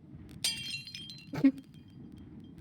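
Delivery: breathy laugh. Real, not acted. Laughing at friend's joke.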